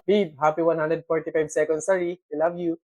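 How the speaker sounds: low, soft practice run; quiet rehearsal. Acted. Practising the greeting for babe, soft and low. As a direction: murmur it like a private rehearsal.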